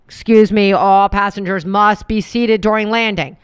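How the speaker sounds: very loud PA call; mock announcement. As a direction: boom it out like an intercom. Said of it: "how many times they have to be like".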